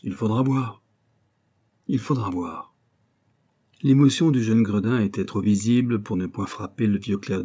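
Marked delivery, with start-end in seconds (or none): 0.0-0.8 putting on a bold front; a touch of swagger in the reply; cocky. A narrator's reading. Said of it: "c'est d'un air crâne qu'il répondit". 1.9-2.8 bravado settling lower; understated show of nerve; cocky, subdued. A narrator's reading. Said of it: "c'est d'un air crâne qu'il répondit".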